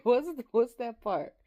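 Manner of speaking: high, slow question through laughter; laughing as you search for it; amused confusion. Real, not acted. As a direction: ask it while tickled.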